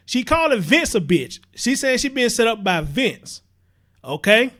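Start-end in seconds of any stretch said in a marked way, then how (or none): none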